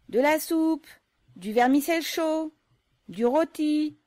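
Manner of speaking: high, loud vendor's cry; mother calling out her dishes to the workers; calling out wares. Acted. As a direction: hawk the food across the yard. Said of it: "la mère criait de temps à autre".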